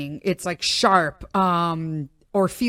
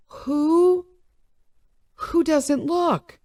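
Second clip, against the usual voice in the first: incredulous; 8 semitones higher, much slower than usual. Retold or imitated. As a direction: ask it like it's unthinkable. Incredulous inner thought again.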